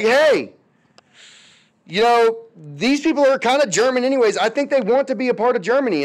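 high, fast, breezy mock pitch; a hint of casual Hitler. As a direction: sell it lightly like a smooth salesman. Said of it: "he's like".

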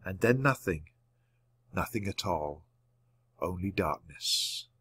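low, soft fade into nothingness; hushed narration. A narrator's reading. Story falls into silence and darkness.

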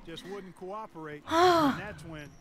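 heavy sigh. Real, not acted. Frustrated at losing game.

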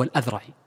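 الأذرعي with an "a" on the opening alif; correct name pronunciation. Shown as a demonstration. Teacher showing students how الأذرعي is read, correct pronunciation with fatha. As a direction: say الأذرعي as "al-Adhra'i", opening on a clear "a". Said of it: "وهكذا ينطق بفتح ال-، الألف".

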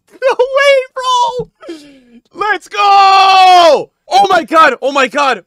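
high, loud outburst easing down; disbelieving celebration. Real, not acted. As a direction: burst out in amazement, then subside. Explodes over the pull.

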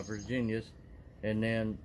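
talking around pipe stem; pipe in mouth. Real, not acted. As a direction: lips clenched on pipe. Seen 0: Pipe stem held between lips until 2.6, then removed.

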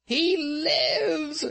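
very high, loud screaming joy; ecstatic scream. A narrator's reading. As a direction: scream it out in wild triumph. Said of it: "she screamed".

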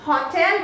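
"होटल" with a full "tel" ending; correct pronunciation. Shown as a demonstration. Trainer correcting learners, correct pronunciation. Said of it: "इसे हम लोग".

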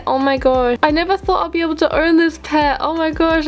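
usual speaking voice

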